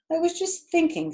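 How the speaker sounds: lightly voiced spider; light musing voice; high spider voice.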